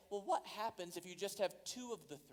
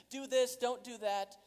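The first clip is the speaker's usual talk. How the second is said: loud, higher soccer-parent shouting; mock sideline yelling; 6 semitones higher, 9 dB louder than usual. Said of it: "just yelling".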